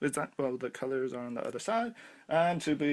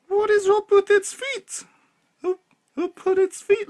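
much higher, louder and slow, in disbelief; shocked squeal; 9 dB louder than usual. Real, not acted. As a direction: squeal out the question as if you can't believe the feet. Shocked by the figure's feet.

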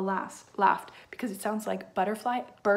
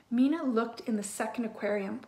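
plain reading voice

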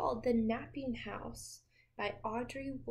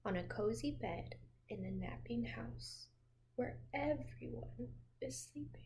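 soft sleepy whisper; 6 dB quieter than usual. Pretend. Hushing the sleeping house for the child, whispered. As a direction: whisper it so nobody wakes.

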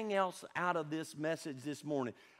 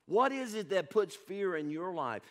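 ordinary talk, nothing marked

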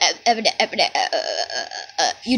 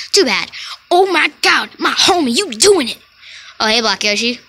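usual speaking voice

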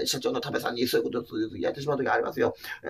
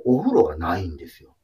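ordinary delivery

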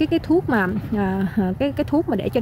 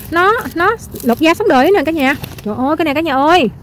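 ordinary delivery

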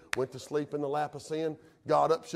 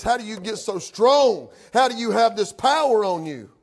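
loud, raised preacher's cry; full-force preaching; 7 semitones higher, 10 dB louder than usual. Real, not acted. Preacher retelling the question put to Samson, forceful preaching. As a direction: press the question with pulpit force.

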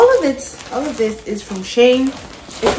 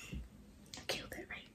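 whisper; 25 dB quieter than usual. Real, not acted. Proud reveal of finished hair.

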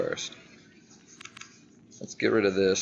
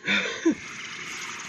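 high airy giggle; 12 semitones higher, 6 dB quieter than usual. Real, not acted. Laughs after compliment.

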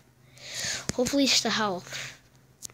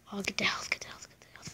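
fading whispered urgency; urgent whisper; 3 semitones lower, 15 dB quieter, much faster than usual. Real, not acted. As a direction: whisper it tensely, trailing off. Urgently grabbing health.

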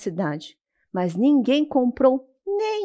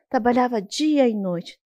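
usual speaking voice

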